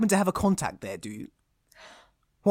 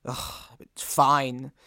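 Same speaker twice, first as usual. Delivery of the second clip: grudging; 6 semitones lower, 7 dB quieter, much slower than usual. Acted. Reluctant grudging agreement.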